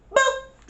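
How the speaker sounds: high, short boop; imitated phone beep. Phone dies as he answers, imitated boop. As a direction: pop out an electronic blip.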